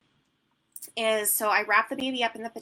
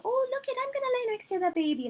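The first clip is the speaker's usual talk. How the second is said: coaxing parent. Retold or imitated. Parent voiced coaxing the toddler.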